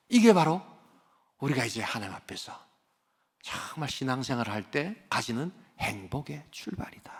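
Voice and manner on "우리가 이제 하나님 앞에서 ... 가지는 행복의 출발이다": softer, hushed whisper. Real, not acted. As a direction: whisper it like a secret. Preacher whispers the point. (속삭이듯 낮게)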